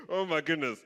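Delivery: usual speaking voice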